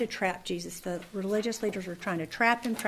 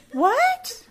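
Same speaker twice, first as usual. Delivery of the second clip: high, loud startled what; shocked what; 10 dB louder than usual. Retold or imitated. Caught off guard by her husband, startled yelp. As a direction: yelp it in disbelief.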